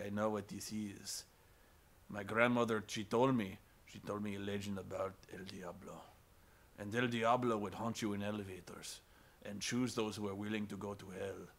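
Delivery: security guard warning of El Diablo, low and solemn; ominous wise guard. Retold or imitated. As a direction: tell the legend low and grave.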